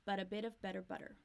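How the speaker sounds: But a bit of better butter said fast, flaps linked; fast flap T run. Shown as a demonstration. Teacher repeats but a bit of better butter at speed. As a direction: rattle it off quickly. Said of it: "a lot of flap T".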